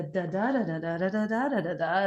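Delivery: wordless da-da-da rhythm; mimicked speech rhythm. Shown as a demonstration. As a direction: tap out speech melody on "da". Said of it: "trying to mimic this".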